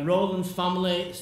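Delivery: usual speaking voice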